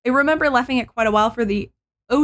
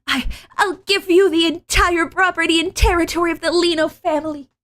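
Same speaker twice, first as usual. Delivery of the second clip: anime voice; 9 semitones higher, 5 dB louder than usual. Acted. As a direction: lean harder into the anime heroine. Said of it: "anime enough".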